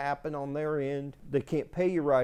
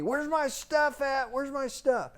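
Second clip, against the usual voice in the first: high, slow mock yell of an angry tenant; imitating a renter demanding his things; retold angry yelling; 10 semitones higher than usual. Tenant on the phone demanding his stuff, mock yell. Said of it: "Guys yelling, screaming at me".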